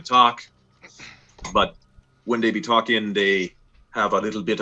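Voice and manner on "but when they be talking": usual speaking voice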